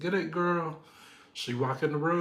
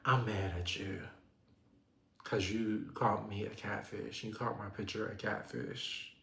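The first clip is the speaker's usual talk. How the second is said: imitating Angel; 3 semitones lower, 7 dB quieter than usual. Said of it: "And she".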